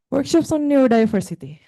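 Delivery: breathless, rushed and loud; gasping the words out; out of breath. Real, not acted. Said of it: "I'm out of breath".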